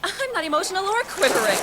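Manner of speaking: high, loud, exasperated denial; worked-up, defensive yell; emotional outburst. Acted. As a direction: shriek the denial in frustration. Escalating denial of quivering voice.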